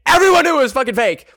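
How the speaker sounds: high, loud, rushed exclamation; indignant outburst. Real, not acted. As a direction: burst out fast and high in outrage. Indignant outburst over fake accent.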